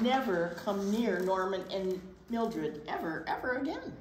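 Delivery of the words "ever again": ordinary delivery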